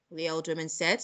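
plain reading voice

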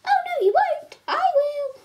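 squeaky, loud cartoon defiance; high Yoshi voice. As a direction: shout back high and cheeky. Yoshi answers back.

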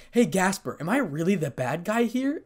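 mildly desperate, seeking an answer. A narrator's reading. Issei turning to Gasper, desperately. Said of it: "asked Issei desperately".

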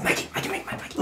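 rapid hushed name-calling; insistent whisper. Acted. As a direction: whisper over and over to a classmate. Sneakily getting classmate's attention.

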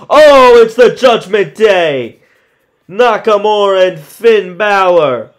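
loud, high entrance call; excited announcer. Acted. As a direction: announce the surprise arrival.

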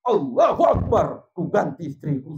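loud, high comic outburst as punchline; acted cry of sudden decision; mock triumphant shout. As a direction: burst out with exaggerated resolve.

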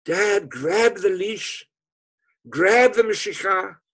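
high, slow, ringing call to his father; slow, high, pleading shout; urgent plea. Acted. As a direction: call it out like a desperate summons across distance. Son's urgent note to father.

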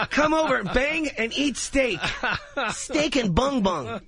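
high, drawn-out mock invitation; mock sales pitch. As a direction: stretch it out like a cheesy slogan. Mock pitch for swingers club food.

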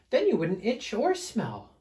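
high storybook character voice; Big Fluffy Bear. Acted. Fluffy bear explaining to smelly bear, high and lilting. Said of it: "asked Big Fluffy Bear".

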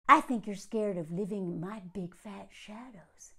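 mock whisper. Mocking the proposal insult in a breathy whisper. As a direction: breathe it like a smug smooth-talker.

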